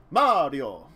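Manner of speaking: bad Italian accent. Shown as a demonstration. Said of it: "I'll keep doing it in the bad Italian accent".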